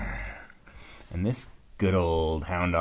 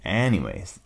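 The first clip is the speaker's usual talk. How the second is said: plain reading voice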